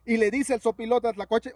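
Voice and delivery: usual speaking voice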